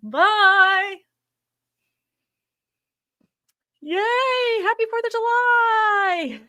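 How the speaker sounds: high, drawn-out festive call; sing-song goodbye. Real, not acted. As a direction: sing the holiday wish out. Festive sign-off.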